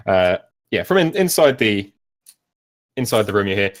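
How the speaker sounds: ordinary delivery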